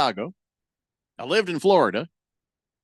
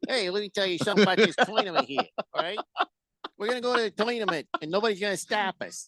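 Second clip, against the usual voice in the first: hammed-up accent with TOY-nament, higher and quick; mock accent; 5 semitones higher, 5 dB quieter than usual. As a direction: put on a mock tough-talking accent. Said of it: "Sounds like you say tournament".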